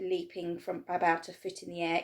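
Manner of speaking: ordinary delivery, nothing marked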